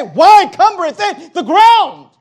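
high, loud outburst, easing at the end; thundering divine indignation. Acted. God looking down at a barren church, indignant shout. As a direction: cry out God's outrage from the pulpit.

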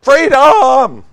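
high, drawn-out battle cry; full-voiced rebel war cry; William Wallace. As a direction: bellow it long and loud like a rallying warrior. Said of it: "do my William Wallace impression".

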